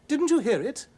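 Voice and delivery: high, loud, pressing question; indignant question. A narrator's reading. Wife demanding if George heard the owls, high and loud.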